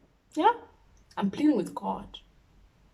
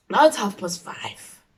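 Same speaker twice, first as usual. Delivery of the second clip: ordinary talk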